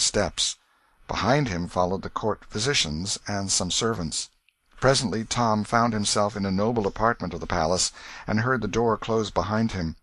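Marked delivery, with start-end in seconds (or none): none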